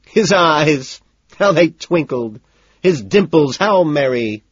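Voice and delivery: high, loud mock-merry verse; gleeful recital. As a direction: exclaim it like a hammed-up Christmas poem. Gleeful verse after laughing out loud.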